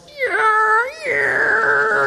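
high, drawn-out dial-up screech; imitated slow internet. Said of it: "this is, like, slow. This is like".

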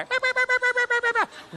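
very high, rapid little yaps; chihuahua barking. Retold or imitated. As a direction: yap like a tiny dog at a train. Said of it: "a chihuahua barking at the train".

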